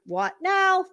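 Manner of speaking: ordinary delivery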